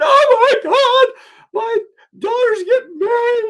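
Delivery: high, loud, near-tearful panic; frantic woman. Retold or imitated. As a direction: wail it like a panicked mother. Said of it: "she is frantic".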